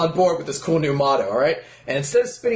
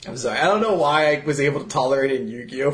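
ordinary talk

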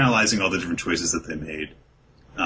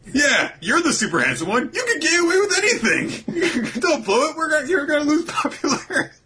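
much higher, laughing tease; giggly teasing. Real, not acted.

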